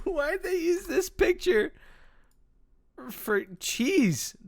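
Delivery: very high, laughing squeal; laughing disbelief. Real, not acted. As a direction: squeal the question through laughter. Laughing at disgusting picture.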